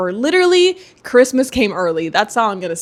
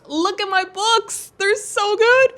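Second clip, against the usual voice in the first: gushing delight; 10 semitones higher, slower than usual. Real, not acted. Gushing over books.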